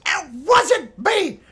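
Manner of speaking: high, loud goblin protesting innocence. Acted. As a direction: squawk denials like a cornered goblin. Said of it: "this little goblin tied to the post says".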